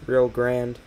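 GRANDE said as English "grand"; one-syllable GRANDE, like "grand"; mispronounced GRANDE. Real, not acted. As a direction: drop the final vowel of GRANDE. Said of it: "forget how to pronounce Grande".

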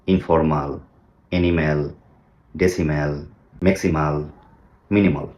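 each word ending in an open "mal"; wrong mal endings. Shown as a demonstration. Teacher reads a list of words the wrong way. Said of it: "মালের একটা গন্ধ পেয়েছি".

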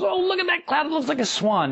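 high, bright-eyed mock friend pointing; excited friend. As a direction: squeal it like a delighted cloud-spotter.

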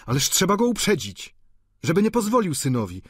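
urgent exclaim. Acted. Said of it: "zawołał Eugeniusz".

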